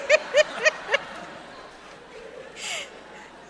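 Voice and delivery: breathy high laughter trailing up; airy rising giggle. Real, not acted. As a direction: laugh it off in disbelief. Laughs after disbelief.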